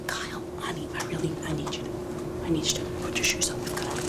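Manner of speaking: soft unvoiced whisper to her son. Mom whispering to her son to put his shoes on. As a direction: whisper it gently so he has to lean in. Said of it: "Why are you whispering?".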